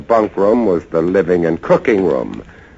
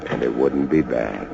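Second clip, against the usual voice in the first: half to himself, softly; hushed; 10 semitones lower, 7 dB quieter than usual. Acted. Lighthouse keeper remembering peaceful nights, hushed. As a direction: drop to a soft, contented murmur. Narrator musing on quiet nights.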